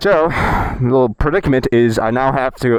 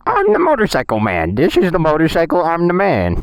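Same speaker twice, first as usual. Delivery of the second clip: high, goofy tough-guy announcer; silly voice; 6 semitones higher than usual. Pretend. Showing off for his girlfriend in a silly voice. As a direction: ham it up as the motorcycle man. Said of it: "I wanted to do a silly voice".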